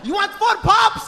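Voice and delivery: high, drawn-out pushy question; yelling shawarma seller. Acted. Seller upselling drinks to customer, yelling. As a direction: shout the order at them, high and slow. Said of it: "Why are you yelling at me".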